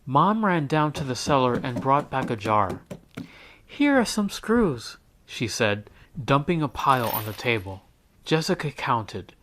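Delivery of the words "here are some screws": helpful mom. A narrator's reading. Mom offers screws.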